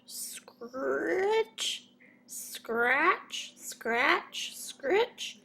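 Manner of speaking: high, rising scritch scratch sounds; a mysterious scratching sound close to Peter; scratching noise. A narrator's reading. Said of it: "Peter heard a sound".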